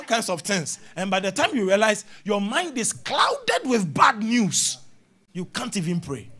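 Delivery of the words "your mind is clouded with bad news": slow, raised preaching shout, stretched out; emphatic shout. Real, not acted. Preacher warns the congregation, slow emphatic shout. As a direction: hammer the warning home, drawn out. Preacher warning about bad news.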